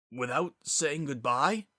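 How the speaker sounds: slow, disbelieving first asking; a stunned, half-whispered disbelief; stunned question. Acted. Zephyr's voice rose.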